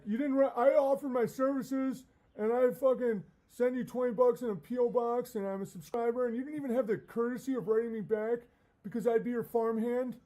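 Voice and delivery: high, whiny entitled fan rant; offended fan. Retold or imitated. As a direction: voice a hurt subscriber complaining. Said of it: "It's like".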